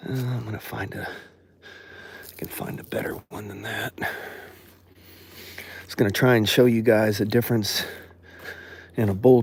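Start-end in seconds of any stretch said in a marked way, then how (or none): 1.1-3.9 whispered aside. Real, not acted. Mutters aside while searching.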